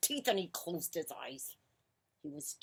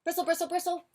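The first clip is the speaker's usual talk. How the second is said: high-pitched, repeated brushing noise; brushing sound effect; 12 semitones higher, 4 dB louder, faster than usual. A narrator's reading. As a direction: make the word scrub like a toothbrush. Imitated noise of toothbrushing.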